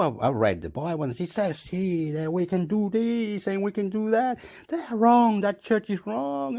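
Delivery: high, climbing indignant argument; heated protest. Retold or imitated. Acted heated protest over the Bible.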